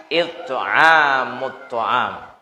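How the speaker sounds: very slow drawn-out Arabic phrase; slow solemn Arabic recital; slow recitation. Acted. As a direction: stretch each syllable of the hadith. Prophet's answer recited.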